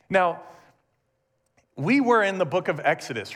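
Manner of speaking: ordinary talk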